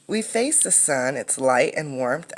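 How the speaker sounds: normal talking voice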